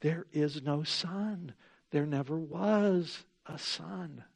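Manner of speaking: lightly voiced hypnotic witch. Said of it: "The witch just keeps continuing".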